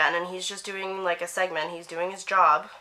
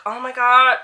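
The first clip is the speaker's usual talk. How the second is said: loud, higher-pitched fangirl outburst; imitated fangirl gasp of excitement; 4 semitones higher, 9 dB louder than usual. Retelling her reaction to spotting a celebrity, gushing fangirl excitement. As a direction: burst out like a starstruck young fan. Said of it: "completely went fourteen-year-old girl".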